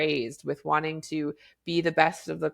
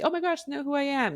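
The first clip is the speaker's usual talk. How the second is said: high, giddy starstruck squeal; much higher giddy fangirl gasp; 10 semitones higher than usual. Retold or imitated. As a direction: gush like a fan meeting her idol. Fan imagining teacher knows her.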